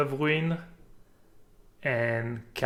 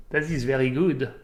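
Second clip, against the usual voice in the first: "very good" with a French R, "That is" said as "zat iz"; smug Frenchman approving, French accent; mock French accent. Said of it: "wants me to speak with a French accent".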